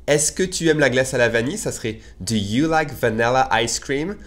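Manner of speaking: normal talking voice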